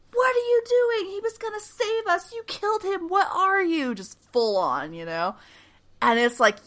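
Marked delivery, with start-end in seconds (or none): 0.1-4.0 much higher, frantic retold panic; panicked high voice; freaking out. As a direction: shriek the accusation in horror. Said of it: "Mary is freaking out".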